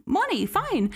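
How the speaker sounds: frantic attendant bargaining with the hijacker; frantic offer. Retold or imitated. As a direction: talk fast to talk a man out of a bomb. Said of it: "and says, like".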